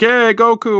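mock fan yell, high and loud; excited high-pitched imitation of a crowd cheer; imitating cheering crowd. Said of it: "where people would be yelling".

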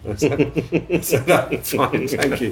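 amused, laughing through the retelling; laughing while talking. Real, not acted. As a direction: let a chuckle break into the words. Amused retelling of awkward meeting.

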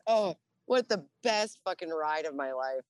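ordinary delivery

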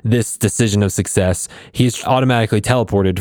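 ordinary talk

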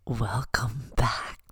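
breathy unvoiced whisper; mock ASMR welcome whispered into a sensitive mic. Shown as a demonstration. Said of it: "You wanna hear?".

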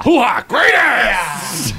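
loud barking hoo-ah shout; Al Pacino hoo-ah. Retold or imitated. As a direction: bark it like a gung-ho colonel.

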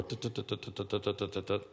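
quick, soft run of tuts; rapid, low "tut-tut-tut"; imitated move counting. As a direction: tick off moves under your breath. Imitates rapidly counting chess moves.

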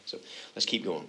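ordinary talk

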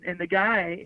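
usual speaking voice

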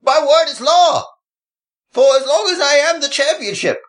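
booming high-pitched tyrant decree; roaring tyrant king. Acted. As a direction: proclaim it like a mad king. Ranting champion persona.

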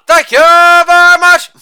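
high, loud, sustained catchphrase; showman's ringing thank-you; showy sign-off. Acted. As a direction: belt it out on one held high note.

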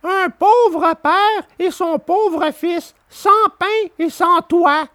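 high, slow humble plea; Pinocchio pleading. A narrator's reading. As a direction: ask meekly for shelter. Pinocchio asks for help.

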